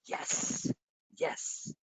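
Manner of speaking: breathless little whisper; whispered yes. Retold or imitated. Host showing how his heart reacts, a quiet whisper. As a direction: whisper it like your heart leaps. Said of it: "my heart just go".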